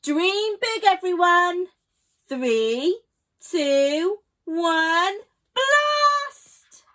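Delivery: loud countdown rising to a high shout; excited countdown. A narrator's reading. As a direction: Sheila counts down to launch, loud and thrilled. Sheila's countdown to launch.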